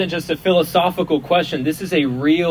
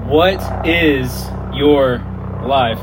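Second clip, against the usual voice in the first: slow dramatic repeat; 2 semitones lower, much slower than usual. Acted.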